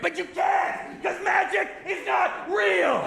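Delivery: high, drawn-out mock-bitter yell; angry outburst. Comic shatters a wish to disappear, bitter high outburst. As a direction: wail it slowly, pitch shooting way up. Outburst punchline at imagined magic wisher.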